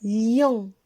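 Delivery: lion said loud and clear; lion, two clear sounds; model word. Shown as a demonstration. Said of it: "Le deuxième mot".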